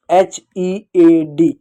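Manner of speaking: slow, deliberate letter-by-letter spelling. Shown as a demonstration. Teacher spelling a word for students, slowly letter by letter.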